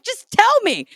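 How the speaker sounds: very high, bewildered mock whine; confused pleading. Teen begs sister to explain, very high confused mock plea. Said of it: "Still didn't get it".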